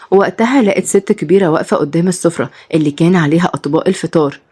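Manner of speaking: plain reading voice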